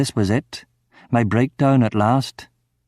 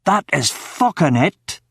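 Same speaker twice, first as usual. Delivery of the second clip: scream; 5 semitones higher, slower than usual. Acted. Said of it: "I screamed".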